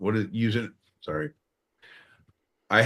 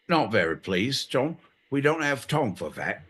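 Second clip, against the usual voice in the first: ordinary talk, nothing marked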